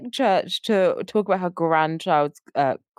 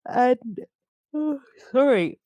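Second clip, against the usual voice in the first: words through a yawn; 4 semitones higher, slower than usual. Real, not acted. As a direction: stretch it out with a yawn. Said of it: "Sorry for the yawn".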